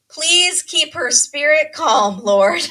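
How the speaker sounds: mom's pleading prayer. Retold or imitated. Mom in the back seat prays for the bride, pleading. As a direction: plead to heaven, drawing the words out.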